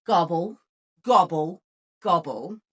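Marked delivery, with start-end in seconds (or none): none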